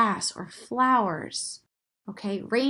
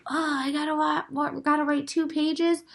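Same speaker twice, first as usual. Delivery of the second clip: higher, mock complaining student; mock whining student; 6 semitones higher than usual. Teacher mimics students complaining about work. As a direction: play a student groaning at homework. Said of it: "don't look at this and say".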